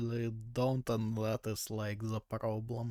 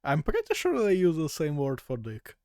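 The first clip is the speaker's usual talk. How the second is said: amused outburst; 5 semitones higher, 10 dB louder than usual. Real, not acted. Laughing aftermath of butter shock.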